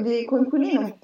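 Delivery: usual speaking voice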